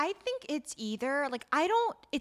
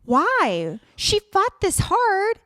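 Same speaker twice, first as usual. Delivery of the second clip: much higher, louder, slow frustrated cry; exasperated outburst; 9 semitones higher, 10 dB louder, much slower than usual. Real, not acted. Host ranting about the heroine giving up, loud and exasperated. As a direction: protest in real disbelief. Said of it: "exasperated at heroine".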